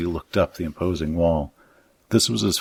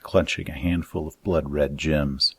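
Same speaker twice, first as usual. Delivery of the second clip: plain reading voice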